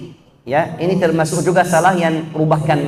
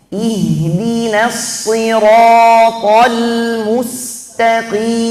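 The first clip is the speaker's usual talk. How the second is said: slow, higher melodic recitation of the verse; Quran recitation; 4 semitones higher, 3 dB louder, much slower than usual. Acted. Teacher reciting the verse in full, melodic and slow. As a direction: chant the line slowly and higher.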